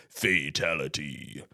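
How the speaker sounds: low, drawn-out game announcer voice; deep, slow video-game verdict; Mortal Kombat announcer. Retold or imitated. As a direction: intone it slowly and ominously like a fighting game. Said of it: "remember the game Mortal Kombat".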